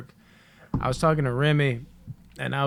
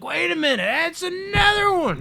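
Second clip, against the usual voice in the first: sudden realisation; 4 dB louder than usual. Retold or imitated. Realising mid-story it happened again, high mock astonishment. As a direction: play the moment it dawns, pitched way up.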